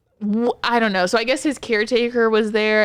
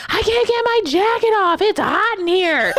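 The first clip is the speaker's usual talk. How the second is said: put-on much higher whiny old man; 12 semitones higher, 4 dB louder than usual. Therapist imitating the patient whining, high-pitched. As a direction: imitate him complaining. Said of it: "he's like".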